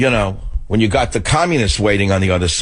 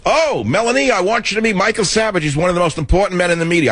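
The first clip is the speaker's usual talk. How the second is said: higher, quick, light imitation of Trump; imitating Trump; 9 semitones higher than usual. Host retelling Trump introducing him to his wife, pitched-up quick imitation. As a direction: rattle off the introduction pitched up and fast. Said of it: "he says".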